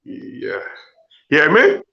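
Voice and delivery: ordinary talk, nothing marked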